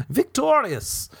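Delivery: very high, drawn-out victory cry; mock triumph. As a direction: crow it like a hammed-up champion. Mock triumph walking away.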